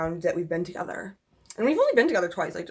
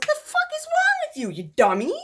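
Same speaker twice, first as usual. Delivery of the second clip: retold outburst; 4 dB louder than usual. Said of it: "and I was like".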